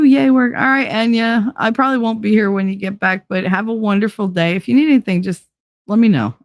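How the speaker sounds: ordinary delivery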